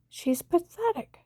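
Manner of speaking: contemptuous, drawn-out "pathetic"; scornful repeat. Retold or imitated. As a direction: stretch out "pathetic" in disgust, up high.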